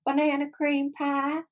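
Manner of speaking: high, slow Texan twang imitation; mock Texan accent. Coworkers mimicking her pie order, exaggerated Texan accent. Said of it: "maybe it's Southern".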